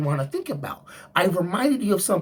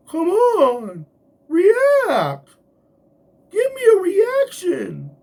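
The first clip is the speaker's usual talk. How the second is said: high, loud mocking taunt; taunting coworker; 13 semitones higher, 7 dB louder, much slower than usual. Taunting coworker who pokes him, high loud mocking voice. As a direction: needle him for a reaction, pitched way up.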